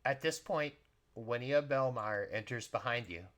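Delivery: ordinary talk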